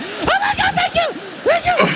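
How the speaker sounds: very high, laughing, gushing thank-yous; relieved laughing thanks. Retold or imitated. Relief that he took it well.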